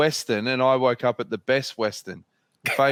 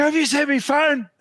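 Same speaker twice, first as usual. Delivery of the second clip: high, strained panicked squeak; 14 semitones higher, 4 dB louder than usual. Retold or imitated. Late rider begging teammate for his lost phone, high panic. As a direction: play it frantic and desperate.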